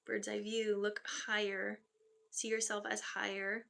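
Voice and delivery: slow, measured channeling voice; channeled message. Acted. Medium passing spirit words to client, slow and measured. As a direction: deliver the spirits' words slowly.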